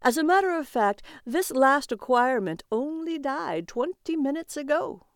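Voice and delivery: high-pitched auctioneer's announcement. A narrator's reading. Auctioneer presents the latest soul, high announcing voice. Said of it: "the auctioneer said".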